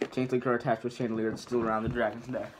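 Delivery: usual speaking voice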